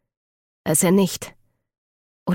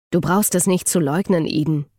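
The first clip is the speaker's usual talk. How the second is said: usual speaking voice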